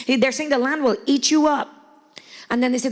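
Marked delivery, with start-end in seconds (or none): none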